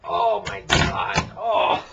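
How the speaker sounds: loud, slow, high shocked oh my God; real disbelief at what came out; shocked gasp. Real, not acted. Pulls gunk from drain.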